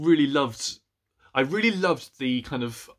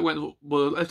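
normal talking voice

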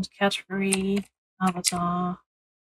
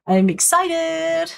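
high, drawn-out excited squeal of a word; real excitement, stretched out and soaring in pitch; 9 semitones higher, slower than usual. Real, not acted. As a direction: sing out your excitement, long and high. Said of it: "So excited".

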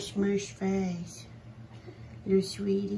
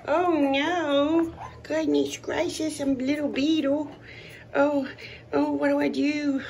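high, louder squeaky puppy voice; voicing the puppy; 8 semitones higher, 5 dB louder than usual. Owner speaking for a wriggling pup, high squeaky panic. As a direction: play the panicked little pup.

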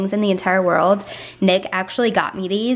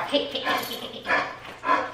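hushed calming; 3 semitones higher, 8 dB quieter than usual. Real, not acted. Calming jumping dog.

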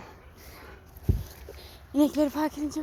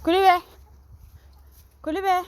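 loud command; 6 semitones higher, 5 dB louder than usual. Real, not acted. Sending dog to kennel.

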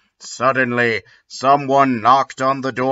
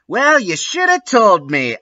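retort, bigger; 8 semitones higher than usual. A narrator's reading. Ebony retorting to Lupin again. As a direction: push it further, pitched up. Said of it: "I replayed".

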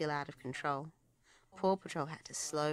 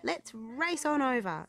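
eager; 8 semitones higher, 5 dB louder than usual. A narrator's reading. Alex eager to go.

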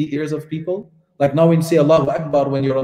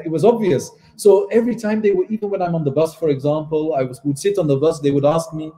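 usual speaking voice

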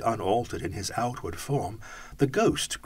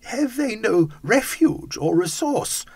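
put-on higher, slow, pleading old man; anguished cry; 13 semitones higher, 6 dB louder than usual. Scrooge pleads for the children, higher anguished cry. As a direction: beg for the children's sake. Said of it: "cried Scrooge".

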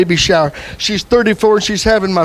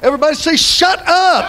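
high, ringing preacher's cry; call-and-response preaching; 9 semitones higher than usual. Real, not acted. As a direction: call it out for the congregation to echo. Preacher leads congregation in response.

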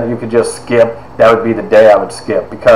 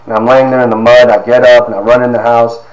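ordinary talk

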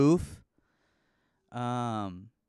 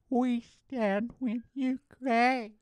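very slow and much higher, sarcastically sappy; mock earnest slogan, pitched up; 10 semitones higher, much slower than usual. Mocking Instagram posters, high and sappy. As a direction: stretch it high and syrupy. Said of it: "saying things like".

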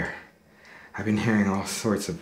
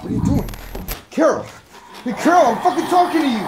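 loud, high, furious yell; angry shouting; 10 dB louder than usual. Acted. Man confronting Carol who ignores him, furious shout. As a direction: yell to get Carol's attention.

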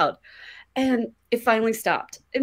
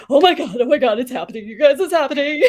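excited; 6 semitones higher, 6 dB louder, faster than usual. Retold or imitated. Hearing a noise, thinks scare.

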